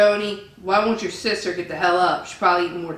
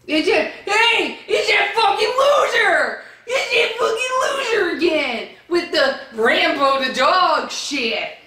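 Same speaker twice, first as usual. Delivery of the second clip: much higher, shouted glee at a troll; excited yelling; 9 semitones higher than usual. Real, not acted. Spots troll, yells in excitement.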